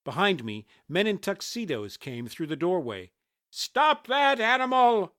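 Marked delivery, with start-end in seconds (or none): 3.5-5.1 slow, high, loud holler; shouted. A narrator's reading. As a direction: shout to stop a fleeing attacker. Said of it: "one of them shouted".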